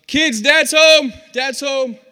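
high, loud drawn-out call; mother yelling out. Retold or imitated. Mom calling the kids to Dad, yelling out. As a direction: holler it through the house. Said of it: "my mom used to yell out".

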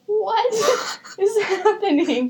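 high, laughing retold disbelief; mock disbelief. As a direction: play the stunned relatives, cracking up. Relatives' shocked reaction, retold.

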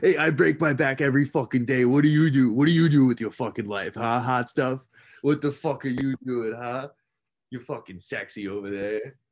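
rough-talking tough guy, starting loud and easing off; Ray Liotta. Acted. Comic riffing at a friend, celebrity impression. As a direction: start forceful, then let the swagger settle down. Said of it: "All right, Ray Liotta".

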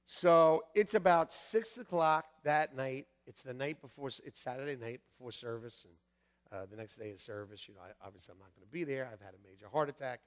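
ordinary talk, nothing marked

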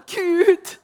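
high, loud cry of fear; loud, high scared cry to God in the night; frightened cry to God. Retold or imitated. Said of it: "Så jeg sa".

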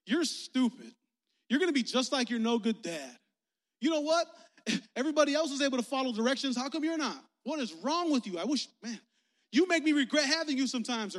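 acted high, loud berating; scolding parent. Parent berating a child who can't follow directions, acted angry scolding.

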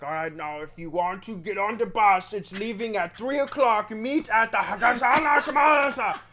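put-on loud, high Prague tour-guide accent, building into gibberish; mock Prague accent. Tour guide announcing bus time over microphone, mock Prague accent. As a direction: imitate a Czech guide, rising into loud garble. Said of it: "these crazy fucking accents that you can't understand".

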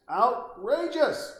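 Outrageous! as a slow, soaring mock-outraged yell; outraged exclamation. Shown as a demonstration. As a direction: stretch Outrageous! into a big indignant cry. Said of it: "This is outrageous".

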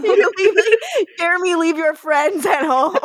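yelling through laughter; mock scolding while laughing. Mocking Jeremy scene line.

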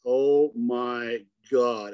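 slow, drawn-out amazed "oh my God"; retold awe. Guide retelling his first look at the buck, awestruck. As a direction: stretch each word out in awe. Spots huge buck from hilltop.

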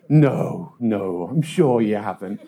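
low, soothing brush-off; dismissive lady. Retold or imitated. Woman answering injured paperboy, dismissive. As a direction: sink down in pitch, unconcerned. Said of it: "my dismissive lady".

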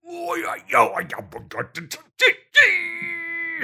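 very high crying bee voice; straining bee. Acted. The desperate bee straining out honey, very high cry. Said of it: "said the bee".

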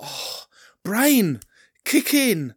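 annoyed at forgetting; frustrated. Real, not acted. Can't recall a name.

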